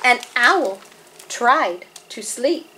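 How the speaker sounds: deliberate picture-book narration with vivid animal noises; story reading to children. A narrator's reading. Narrator tells children of sleepless Owl, deliberate expressive storytelling. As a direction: read to little ones, spacing out the refrain.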